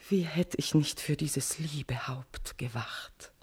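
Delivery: whispered longing; tender whisper. Acted. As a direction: whisper it like a caress. Queen's tender confession.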